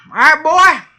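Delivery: a hammed-up Southern drawl, half joking. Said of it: "this is my southern drawl here".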